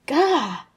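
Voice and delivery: short, high, loud yelp of surprise; startled cry. Acted. Tony startled by Natasha's sudden appearance, startled cry. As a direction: cry out as if someone just popped up.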